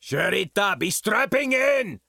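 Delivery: high, desperate bellow; terrified, urgent yell. A narrator's reading. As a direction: bellow a warning from down below. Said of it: "Bin Rogenston bellowed from down in engineering".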